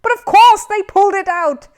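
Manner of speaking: high, loud, exasperated host; exasperated outburst. A narrator's reading. Purple-faced host snapping back at Paddington. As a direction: lose your temper on air.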